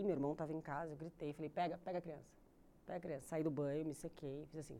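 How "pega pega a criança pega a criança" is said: ordinary talk, nothing marked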